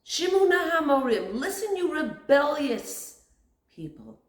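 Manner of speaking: loud, high outburst, slowing on rebellious; blurted rebuke. Retold or imitated. Moshe blurting at the rebellious people, loud. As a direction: burst out at the crowd. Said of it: "he blurts out".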